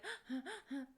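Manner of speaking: quick shallow gasping demo pant; out-of-breath pant. Shown as a demonstration. Coach showing students nervous breathing, short shallow gasp. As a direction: gasp in fast like you just ran upstairs. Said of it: "we kinda tend to pant like".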